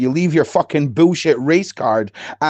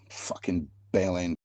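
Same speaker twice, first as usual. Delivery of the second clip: low muttered insult; 8 semitones lower, 13 dB quieter than usual. Real, not acted.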